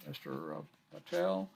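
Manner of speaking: ordinary talk, nothing marked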